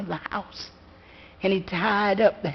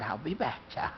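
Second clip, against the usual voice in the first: low, hushed and heavy; quiet grieving father; 9 semitones lower, 13 dB quieter than usual. Acted. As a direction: murmur it with grief.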